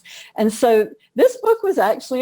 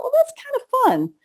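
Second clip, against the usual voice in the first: high, quick playful inner voice; playful thought; faster than usual. Retold or imitated. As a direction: toss it off brightly as a sudden idea. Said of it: "And so I thought".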